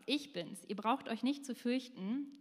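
ordinary talk

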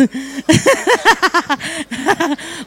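long, high burst of laughter; laughing. Real, not acted. Speaker after joking about Mum and Dad, laughing. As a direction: let a real laugh run on.

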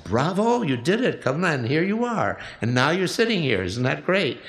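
lifted, delighted congratulations; cheering her on. Retold or imitated. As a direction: applaud her like a proud coach. Cheering on the nervous lady.

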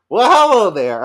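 high, loud, sing-song greeting; loud high "well hello there". Retold or imitated. Roommate walking in on them, loud sing-song greeting. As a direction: call it out cheekily, high and loud. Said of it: "she goes".

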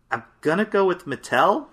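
questioning guess climbing high at the end; voice going up. Real, not acted. Contestant guessing at the answer, voice going up. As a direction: push the pitch up as you commit. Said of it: "keep making your voice go up".

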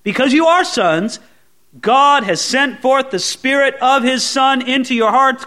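ordinary talk

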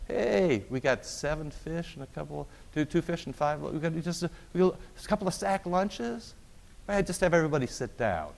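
breezy Jesus sorting out lunch for five thousand; casual Jesus voice. Retold or imitated. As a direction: play Jesus as a laid-back host. Jesus voiced feeding the crowd.

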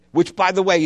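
ordinary delivery

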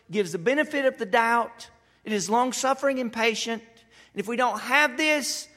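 high, loud, driving sermon voice; loud, fiery pulpit preaching; preaching at full force. Acted. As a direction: build the preaching till it rings.

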